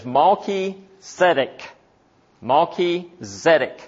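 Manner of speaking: Malki Zedek as two separate words; "Malki", a short break, then "Zedek"; careful Hebrew name pronunciation. Shown as a demonstration. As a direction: sound out "Malki Zedek" deliberately, word by word. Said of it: "we would pronounce it as".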